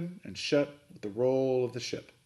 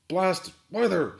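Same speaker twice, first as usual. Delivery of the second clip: slow, louder, higher angry growl; 7 semitones higher, 5 dB louder, slower than usual. A narrator's reading. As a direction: growl it out like a curse. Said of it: "he growled".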